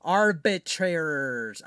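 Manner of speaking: arbitrators sounded out slowly syllable by syllable; puzzling over how to say arbitrators; trying the word again. Shown as a demonstration. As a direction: carefully say arbitrators, slow and deliberate. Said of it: "I probably said arbitrators wrong".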